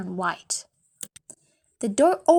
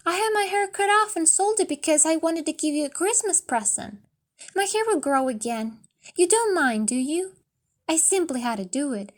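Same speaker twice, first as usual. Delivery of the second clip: put-on higher young woman's voice, coaxing and anxious; pleading young wife; 4 semitones higher than usual. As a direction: coax him not to be upset. Della pleads with Jim.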